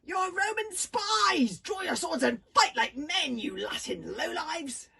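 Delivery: slow, loud, high battle challenge; challenging shout. A narrator's reading. Girl daring the boys to fight, slow loud shout. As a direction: bellow it like a war cry.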